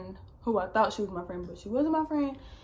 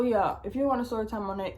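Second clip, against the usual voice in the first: normal talking voice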